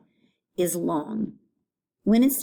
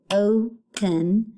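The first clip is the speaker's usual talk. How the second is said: "open" pulled apart as o... pen; first syllable separated. Shown as a demonstration. Teacher pointing out first syllable, "open" split in two. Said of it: "O being the first syllable".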